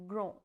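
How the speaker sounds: "grand" as nasal "gran", no final d; "grand" the French way. Shown as a demonstration. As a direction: say "grand" through the nose. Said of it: "nasal sound".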